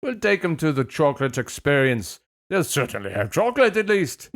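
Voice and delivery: failed Scottish turned very British; lilting mock-British parent; really British accent. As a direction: badly fake Scottish, landing in British. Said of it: "Wow, that was really British".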